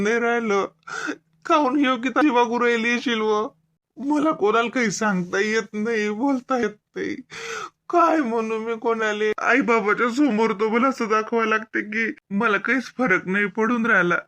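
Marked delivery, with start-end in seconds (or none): none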